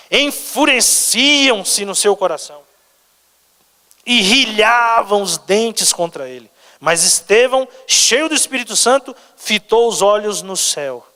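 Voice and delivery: high, slow, stretched proclamation; dramatic scripture reading. Acted. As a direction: draw out the key words from the pulpit.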